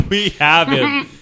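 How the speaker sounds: laughing repeat. Real, not acted.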